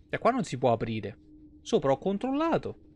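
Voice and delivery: ordinary talk, nothing marked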